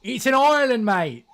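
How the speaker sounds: high, loud mock British bloke; a British fella saying island in his thick accent; heavy British accent. British fella insisting it is an island, loud thick accent. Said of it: "British fella with a heavy accent".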